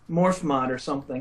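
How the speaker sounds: normal talking voice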